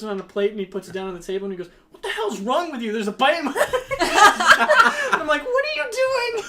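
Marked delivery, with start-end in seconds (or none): 1.9-3.6 high, loud yell breaking into laughter; mock outrage. Friend berates waiter over bitten ham, mock outrage. 3.6-5.1 high, loud burst of laughter; real helpless cackling; uproarious laugh. Real, not acted. Teller cracks up at own ham story, uproarious laugh. 5.4-6.4 very high, loud, laughing yell; mock exasperated shriek. Teller challenges friend over the ham, mock exasperated shriek.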